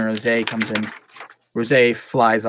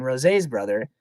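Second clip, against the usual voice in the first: usual speaking voice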